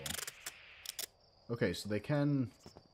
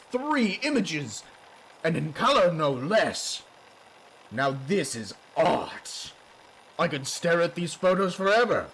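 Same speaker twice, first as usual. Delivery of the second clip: pompous noble voice; 3 semitones higher, 4 dB louder than usual. Acted. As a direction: proclaim it like a pompous lord. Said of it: "I guess I'm gonna go into pompous noble voice".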